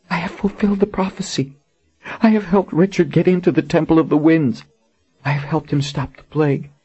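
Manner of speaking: high, despairing queen; Colin in despair. Acted. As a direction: realize the ruin, pitched high. Colin voiced in the story.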